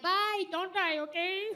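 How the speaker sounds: high, pleading goodbye; anxious kid's goodbye. Retold or imitated. Child begging mom at school drop-off, high and pleading. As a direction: beg her not to die, in a high child's voice. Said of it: "I'd be like".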